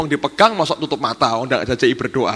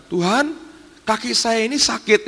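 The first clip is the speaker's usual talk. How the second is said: much higher, anxious complaint to the Lord; a servant's fretful plea, pitched up; pleading maid; 9 semitones higher than usual. Retold or imitated. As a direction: whine that her leg hurts.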